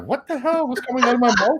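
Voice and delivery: high, startled self-correction; shocked at himself. Retold or imitated. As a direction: catch yourself in horror, half laughing. Catching his own slip mid-sentence.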